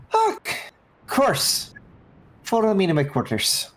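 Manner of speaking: high, eager, welcoming captain; high-voiced captain inviting them to her quarters; captain NPC voice. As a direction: lift it up high and gush a little.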